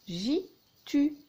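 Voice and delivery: "jitu" high, as two separate syllables. Shown as a demonstration. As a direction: present "jitu" as ji, pause, tu. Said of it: "Et j'ai fabriqué".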